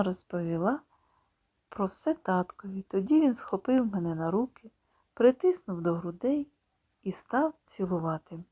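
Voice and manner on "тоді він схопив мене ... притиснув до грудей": ordinary delivery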